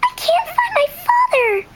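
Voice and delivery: high, tearful little duck voice; a lost duckling wailing for its father; crying duckling. Acted. Duckling crying to everyone that it lost its father.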